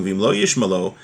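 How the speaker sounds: ordinary talk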